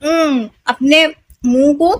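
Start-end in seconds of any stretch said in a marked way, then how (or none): 0.0-0.5 उम as a held "mmm" hum; isolated, sustained M sound for उम; M letter sound. Shown as a demonstration. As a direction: close the lips and hum उम. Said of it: "एम का sound क्या निकलता है?".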